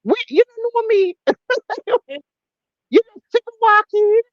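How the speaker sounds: high, outraged mock retort; indignant Jamaican. Voicing an offended person answering the insult. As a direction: snap back in offended disbelief. Acted quarrel, voiced reply.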